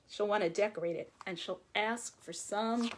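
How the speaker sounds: plain reading voice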